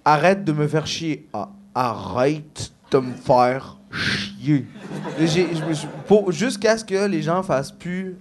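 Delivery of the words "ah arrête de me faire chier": put-on very slow, laboured Québécois accent; practised Québécois accent. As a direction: stretch every word into a thick Québécois accent. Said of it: "build up mon accent québécois". (accent québécois appliqué, très lent)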